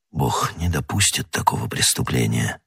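low, hushed dread; tormented suspicion. A narrator's reading. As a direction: say it softly, as if to yourself. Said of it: "терзался страшным подозрением". (тихо и глухо, с ужасом)

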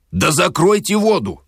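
high, insistent call over noise; contained exasperated yell; acted exasperated shout. A narrator's reading. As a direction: repeat the order, pitched right up. Shouting over running water.

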